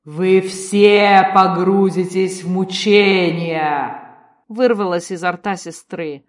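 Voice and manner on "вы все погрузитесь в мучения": loud, slow monstrous voice; demonic voice. A narrator's reading. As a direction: speak as a monster. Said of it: "голос уже ей не принадлежал".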